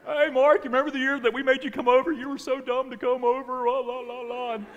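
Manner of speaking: loud, high-pitched taunting imitation of family; hammed-up sing-song ridicule; mocking. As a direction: tease like a jeering relative. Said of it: "terribly laughed at and mocked".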